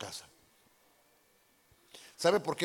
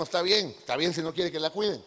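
normal talking voice

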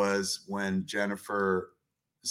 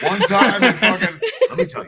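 hammy coworker; 8 semitones higher, 11 dB louder than usual. Retold or imitated. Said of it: "look 'em up".

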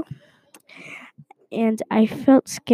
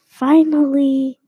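mild shout; 3 semitones higher, 4 dB louder than usual. Retold or imitated. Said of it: "I shouted".

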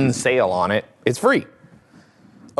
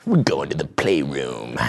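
low seductive line; 9 semitones lower than usual. Shown as a demonstration. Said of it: "repeats the line lower".

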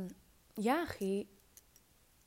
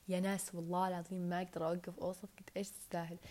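ordinary talk, nothing marked

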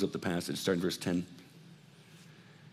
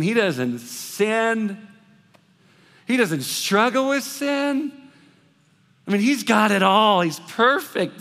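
mock awe; 10 semitones higher, 6 dB louder, slower than usual. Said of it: "put the pastor on this pedestal".

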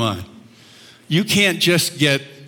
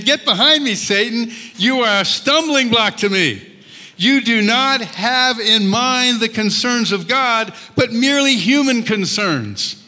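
stern rebuke; 7 semitones higher, slower than usual. Retold or imitated. Jesus rebukes Peter.

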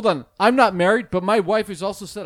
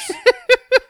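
laughing; 9 dB louder than usual. Real, not acted. Laughing at his own joke.

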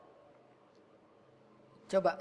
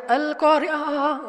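loud, drawn-out mock swoon; put-on overwhelm; 5 semitones higher, 6 dB louder, much slower than usual. Preacher mocking a forced reaction to a verse, exaggerated swoon. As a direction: fake being overcome by the verse, slow and loud. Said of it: "Jangan dibuat-buat".

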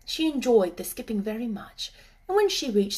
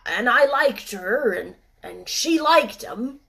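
reluctant; 2 semitones higher, 7 dB louder, slower than usual. Acted. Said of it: "admitted reluctantly".

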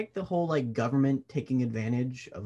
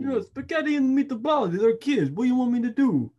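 much higher, louder mock Italian accent; bad Italian accent; 11 semitones higher, 6 dB louder than usual. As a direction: ham up an Italian pasta cliché. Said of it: "We gotta do twenty seconds of bad Italian".

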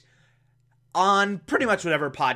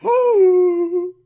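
high shocked gasp; sharp dramatic gasp; 6 dB louder than usual. Retold or imitated. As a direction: gasp in alarm. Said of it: "Batman's like".

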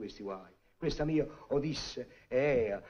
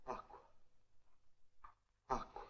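feeble plea; 20 dB quieter than usual. Acted. Exhausted man begs for water.

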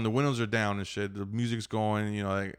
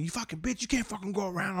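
high, softened imitation of a rant; mock angry cussing; 9 semitones higher, 5 dB quieter than usual. Old driver cussing out the man who passed, mock rant. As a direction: spit curses like a road-rager, volume held back. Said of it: "and he starts cussing".

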